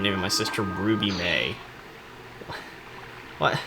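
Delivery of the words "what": usual speaking voice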